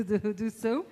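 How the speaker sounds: usual speaking voice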